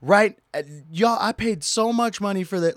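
high, loud mock scream. Lady screaming at TSA agents over her products. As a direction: ham up the lady's angry protest. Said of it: "like, screaming out loud".

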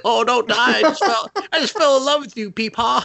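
high, loud, hammed-up pleading; distraught kid. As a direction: wail up high as the kid losing grandpa. Kid pleads with dying old man.

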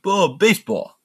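high-pitched mob boss impression; Robert De Niro. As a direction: imitate a movie gangster savoring each line. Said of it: "It's Robert De Niro".

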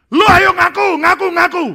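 high, loud pushy yelling; urging shout. Acted. Confessed pastor pressing his friends to confess, loud high yelling. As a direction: hammer them to confess.

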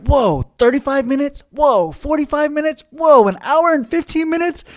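put-on excited kids reading out the run times; amazed siblings. Siblings amazed at episode lengths.

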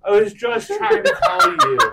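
high squeaky laughter; laughing. Real, not acted. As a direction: push words through laughter. Still laughing.

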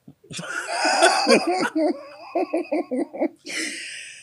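high squealing giggle; high-pitched suppressed laugh fit; stifled giggles. Real, not acted.

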